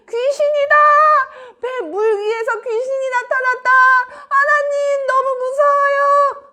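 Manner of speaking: high, wailing cry of terror; frightened shouting. Acted. Disciples seeing a figure on the water, frightened high shouting. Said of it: "하면서 큰 소리를 치는데".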